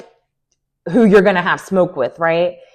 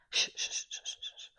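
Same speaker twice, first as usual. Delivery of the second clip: soft unvoiced shush; breathy shh trailing to nothing; 21 dB quieter than usual. Real, not acted. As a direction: hush yourself under your breath. Hushing herself, unvoiced.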